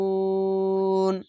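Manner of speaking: মুরিদুন slowly, ending held on নূন; Quranic recitation. Shown as a demonstration. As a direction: stretch মুরিদুন evenly. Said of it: "এখানে দু". (ধীরে টেনে তিলাওয়াত)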